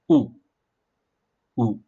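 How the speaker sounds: U said with a sharp falling fourth tone. Shown as a demonstration. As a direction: drop U straight down. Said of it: "with a fourth accent downwards".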